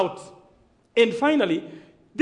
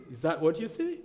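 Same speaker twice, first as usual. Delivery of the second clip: low, soft retold question; hushed question; 7 semitones lower, 8 dB quieter than usual. Asking his friend to confirm the pledge, hushed. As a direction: drop the voice down and ask it softly.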